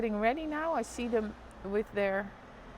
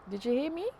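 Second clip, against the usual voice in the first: usual speaking voice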